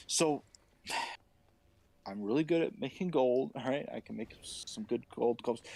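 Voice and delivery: calm him down while stalling; placating Aaron. Acted.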